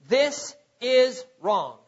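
slow, each word set apart; stern reproof. Acted. Preacher defining reproof for the congregation, stern and deliberate. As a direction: lay down the verdict word by word.

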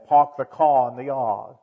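amateur Boston accent with dropped r's. Shown as a demonstration. Preacher demonstrating accents to the congregation, Boston accent. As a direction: imitate a Boston accent, not too expertly. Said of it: "I can't do very many accents".

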